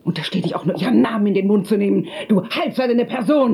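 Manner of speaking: high, indignant outburst; angry. Acted. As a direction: warn her off sharply. Daughter defends dead mother.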